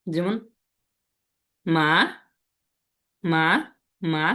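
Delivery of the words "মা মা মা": each "মা" gliding upward; rising second tone. Shown as a demonstration. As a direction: say "মা" lifting at the end, like a question. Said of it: "একটু উপরে উঠবে এরকম". ("মা"-এর সুর উপরে উঠবে)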